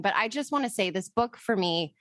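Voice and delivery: usual speaking voice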